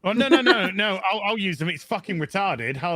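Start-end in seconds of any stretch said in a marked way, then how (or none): none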